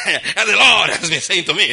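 loud, high mock preacher proclamation; hammed-up pulpit voice with a laugh; theatrical preacher. Mocking preachers who do theatrics, loud hammed-up proclamation. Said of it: "We like to do theatrics".

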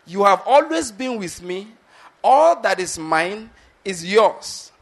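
slow repeat, leaning hard on "always" and "all"; emphatic stress. Acted. Preacher repeats the father's words to his son, stressing "always" and "all". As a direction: draw out "all that is mine", punching "always" and "all". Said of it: "that's my emphasis again".

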